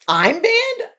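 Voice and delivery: high, rising disbelief; incredulous question. Retold or imitated. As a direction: echo the news back in astonishment. Teller's disbelieving reply to manager.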